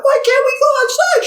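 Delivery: put-on very high, whiny kid voice; child voice. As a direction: ask like a small child. Voicing kindergarten children.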